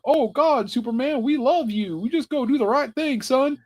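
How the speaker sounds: mock the adoring folks back home; hammed-up proud parents praising their son; mock doting parents. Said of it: "all of them were like".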